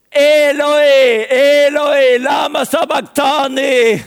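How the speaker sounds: high, loud shout of agony; anguished cry. Acted. Jesus on the cross, crying out to God in anguish. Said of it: "ropar han".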